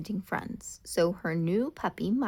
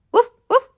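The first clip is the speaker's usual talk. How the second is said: high, loud playful woof woof; puppy bark; 16 dB louder than usual. Acted. Milo barks at Ollie to play fetch, high loud yap. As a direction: yap eagerly for the stick.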